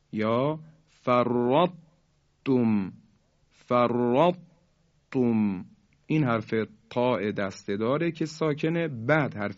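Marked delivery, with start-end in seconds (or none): 0.9-2.9 very slow فرطتم, heavy ط held before a light ت; slow demonstration with a pause on the heavy ط; incomplete merging. Shown as a demonstration. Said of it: "اینطور تلفظ کنیم". 3.5-5.6 very slow فرطتم with a heavy held ط, light ت; slow, deliberate heavy-to-light release; incomplete merging. Shown as a demonstration. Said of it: "اینطور تلفظ کنیم".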